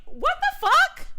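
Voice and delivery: high, slow, loud disbelief; retold outrage. Woman retelling everybody looking at her, high outraged disbelief. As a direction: stretch it out in high-pitched outrage. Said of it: "I was so mad".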